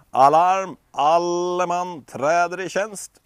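loud, slow commanding call to arms; alarm call. Acted. The guard calling all soldiers to duty, loud. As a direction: bellow the alarm to the troops.

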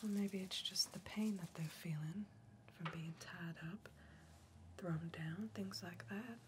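soft unvoiced whisper, close and intimate; hushed soothing whisper; ASMR whisper. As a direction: breathe it like an ASMR video.

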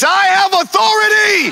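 high, emphatic full-throated shout; shouting, meaning it. Shown as a demonstration. Preacher has congregation say it again like they mean it, shouting. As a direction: shout it with total conviction. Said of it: "say it again like you mean it".

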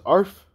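high, short "arf"; imitated dog bark. Akamaru answering Deku's question, high imitated bark. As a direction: yip once like a dog. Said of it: "He goes".

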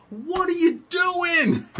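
very high, slow, drawn-out disbelief; baffled teen boy. Retold or imitated. Teen ringleader baffled by the kid's kick, high and drawn out. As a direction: stretch it out in high incredulous shock.

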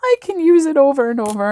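high-pitched, giddy gushing; thrilled squeal settling as it goes; beyond excited. Real, not acted. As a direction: bubble over with excitement, voice lifted high. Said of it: "I'm beyond excited".

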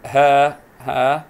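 ها held as a loud "haa"; long vowel. Shown as a demonstration. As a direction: draw out the a of ها forcefully. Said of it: "مد بالألف".

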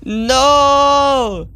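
high, loud drawn-out wail; panicked scream. Real, not acted. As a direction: cry out in alarm at a scare. Jumpscare in horror game.

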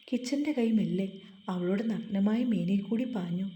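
usual speaking voice

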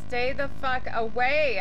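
loud, frightened yell at monsters; panicked yell. Real, not acted. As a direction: shout them away in a panic. Yelling at monsters.

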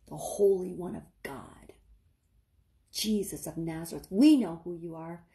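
low, whispered sneer; mock him in a hiss of scorn; scoffing mockery. Demons mocking Jesus, scoffing whisper. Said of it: "saying it with such a mockery, scoffing".

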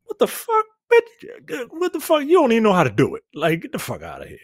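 mock the demon in a high sneering squeal. Friends retelling a horror film, demon taunting the heroine in a high squeal. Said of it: "And then this demon is like".

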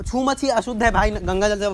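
high, loud warning; loud, urgent objection; alarmed warning. Real, not acted. Warns not to touch impure phone.

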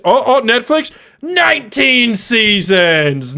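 high, stretched, hammed-up announcement; mock amazement. Streamer pretending Netflix just called with an offer, mock amazed. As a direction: blow up the big news, drawing out each word.

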